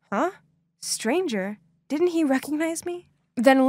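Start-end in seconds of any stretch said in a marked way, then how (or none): none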